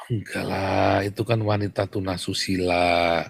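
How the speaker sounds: soft, low polite correction; mock-gentle, hushed denial; mock euphemism. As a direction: imitate someone delicately smoothing over a harsh word.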